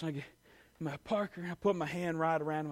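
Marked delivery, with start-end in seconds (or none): none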